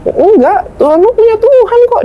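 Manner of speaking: high-pitched imitated retort; insistent contradiction. Opponent challenging the believer about God, insistent contradiction.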